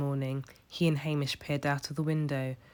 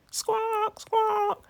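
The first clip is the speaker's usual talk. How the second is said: high shrieking squawk; seagull squawk; 3 dB louder than usual. A narrator's reading. Gulls crying out as they dive, high squawk. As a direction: screech like a gull. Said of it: "they cried".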